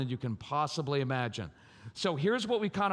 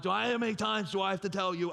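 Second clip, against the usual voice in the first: nagging parent; 2 semitones higher, faster than usual. Acted.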